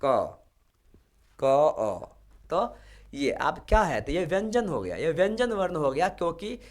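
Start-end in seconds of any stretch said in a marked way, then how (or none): 1.4-2.2 "क, अ" said as two separate sounds; teacher splitting a syllable; sound split apart. Shown as a demonstration. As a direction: break "क" into "क" and "अ". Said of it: "अ ध्वनि भी आ रहा है".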